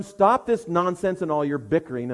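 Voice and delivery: ordinary talk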